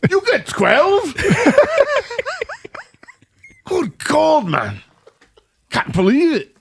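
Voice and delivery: hammed-up Brit gawping at huge portions; mock British amazement. Mocking a British fan amazed at American portions, hammed-up high voice.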